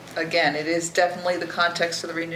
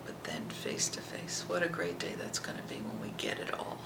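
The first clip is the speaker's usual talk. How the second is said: softer whisper full of wonder; awed hushed whisper; 9 dB quieter than usual. Real, not acted. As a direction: whisper it in awe.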